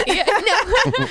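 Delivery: high squeal of laughter; laughing. Real, not acted.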